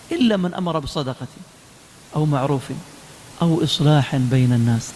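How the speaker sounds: low-pitched, measured verse recitation; Quran recitation. Real, not acted. Preacher giving the verse on reconciliation, in solemn recitation.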